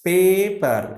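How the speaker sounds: "Paper" split in two, pay-per; syllable by syllable. Shown as a demonstration. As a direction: lift and lengthen the first beat of "Paper", then drop. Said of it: "It's two time".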